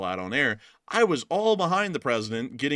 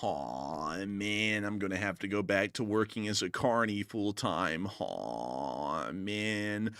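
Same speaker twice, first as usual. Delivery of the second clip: Hot Dog Man fly; 3 semitones lower, slower than usual. Acted. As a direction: moan like a sad loser fly. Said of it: "voiced by none other than the Hot Dog Man".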